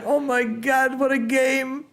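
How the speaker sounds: a lifted, awed "what a game"; amazed exclamation. Real, not acted. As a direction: gasp it out in wonder. Reacting to a missed shot.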